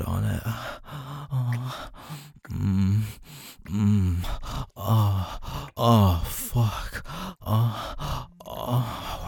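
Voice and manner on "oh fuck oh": usual speaking voice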